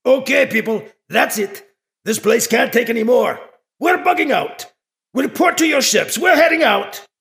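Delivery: urgent barked orders; shouted orders. Acted. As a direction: call out an evacuation to the troops. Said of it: "Erdru shouts".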